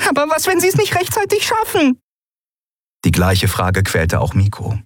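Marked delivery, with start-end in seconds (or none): 0.1-2.0 high, worried voice; frightened girl voice. Acted. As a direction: ask it with real fear. Xea fearful question.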